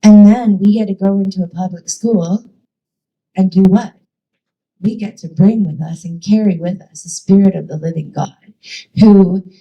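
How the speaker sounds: ordinary talk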